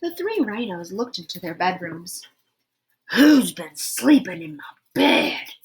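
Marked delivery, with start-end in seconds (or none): 3.1-5.5 big, loud Daddy Rhino voice; great big roar. Acted. Daddy Rhino roaring at his slept-in bed, in a great big voice. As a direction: boom it out like a big papa bear. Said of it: "roared Daddy Rhino in his great big voice".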